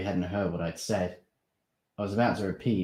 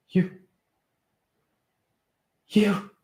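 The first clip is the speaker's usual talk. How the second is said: gasping; 14 semitones higher, 6 dB louder than usual. Acted. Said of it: "Steve was gasping".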